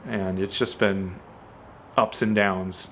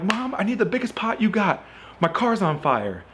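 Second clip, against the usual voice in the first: higher, urgent plea to Mom; 9 semitones higher than usual. Retold or imitated. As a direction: blurt it out in a hurry, fire.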